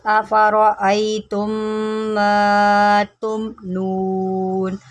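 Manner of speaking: slow, drawn-out tajweed recitation; Quran recitation. Shown as a demonstration. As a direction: chant the verse slowly, held vowels. Recites the verse.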